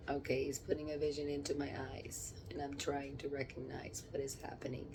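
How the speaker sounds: hushed, unvoiced whisper while seeing a vision. Real, not acted. Medium describing her vision to the camera, whispering. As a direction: breathe the words, no voice at all.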